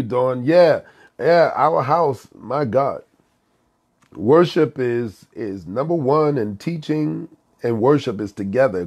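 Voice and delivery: ordinary talk, nothing marked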